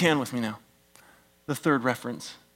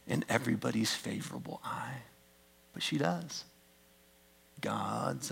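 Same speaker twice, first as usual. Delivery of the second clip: trailing whisper; 3 semitones lower, 13 dB quieter than usual. Real, not acted. Sermon point trailing to hush.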